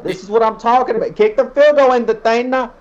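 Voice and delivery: put-on brash New York accent. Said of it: "as [name] does his best New York accent".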